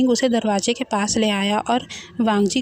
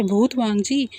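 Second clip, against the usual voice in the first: ordinary delivery, nothing marked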